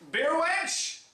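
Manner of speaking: high, drawn-out call across the room. Acted. Calling for the beer server.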